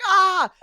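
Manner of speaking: high-pitched mock scream; scared scream. Retelling the tar scene, imitated high scream. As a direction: yelp like the frightened character. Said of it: "he's like".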